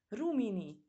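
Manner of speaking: joyful cry. A narrator's reading. Balikó spots his friend, calls out joyfully. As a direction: call out in delight. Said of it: "kiáltott fel örömében".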